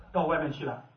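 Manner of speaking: ordinary talk, nothing marked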